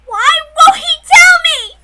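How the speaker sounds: slow, high, very loud cry of despair; anguished scream. Acted. Girl screams at the night sky, anguished and slow. Narrated dam bursting scream.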